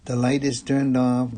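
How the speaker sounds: usual speaking voice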